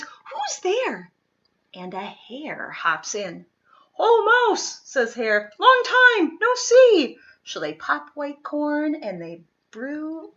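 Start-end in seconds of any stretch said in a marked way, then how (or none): 0.0-1.1 small, high, startled squeak; a tiny voice calling out into the dark; mouse's frightened cry. Acted. Mouse startled by eyes in the den, a high frightened cry. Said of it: "Mouse cries". 4.0-7.2 high, loud and bouncy; cheery hare greeting. Acted. Hare greeting Mouse in the den, high and loudly cheerful.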